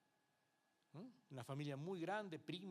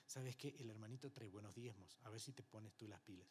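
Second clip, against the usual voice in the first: softer, lower offhand jab; quiet dismissive taunt; 5 semitones lower, 8 dB quieter than usual. Retold or imitated. Pastor quietly needles young Willy about tithes, softly dismissive. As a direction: murmur it like a pastor brushing you off.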